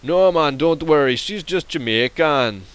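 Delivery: big Jamaican accent, stretching the last words. Shown as a demonstration. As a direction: imitate a Jamaican local brushing it off. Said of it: "talk in a Jamaican accent".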